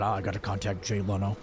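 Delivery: put-on low, gruff old-man mumble; grumpy Harrison Ford. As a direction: grumble like a tired old actor. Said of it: "And he said".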